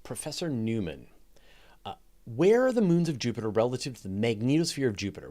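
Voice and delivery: ordinary talk, nothing marked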